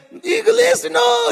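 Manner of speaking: put-on high, loud French-accented cry; hammed-up French accent, pitched high; big French accent. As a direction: cry out as an alarmed French colonel. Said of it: "He's talking his big French accent".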